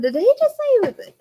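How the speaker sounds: high, loud shocked disbelief. Retold or imitated. Mother retelling her shock at a boy's message, high and loud. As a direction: blurt it out in disbelief. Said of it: "I was just shocked".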